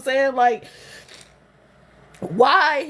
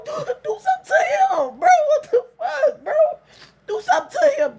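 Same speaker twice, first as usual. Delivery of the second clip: much higher, breaking plea; crying plea; 12 semitones higher than usual. Real, not acted. Begging her family to act on him, crying. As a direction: beg through tears.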